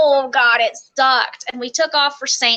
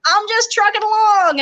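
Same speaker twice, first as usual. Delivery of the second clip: high, sing-song retold answer; calling back; 8 semitones higher than usual. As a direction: act out a carefree trainee calling back. Trainee calling back while marching.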